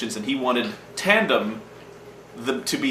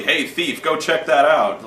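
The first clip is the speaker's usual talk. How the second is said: mock loud call; 4 semitones higher, 4 dB louder, slower than usual. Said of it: "I didn't want them to yell, like, loudly".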